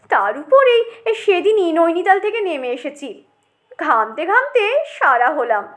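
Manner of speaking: snobbish moan swinging from sigh to protest; pampered potato, heat-weary. Acted. Potato longs for a refrigerator, snobbishly aggrieved. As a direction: whinge like a superior foreigner.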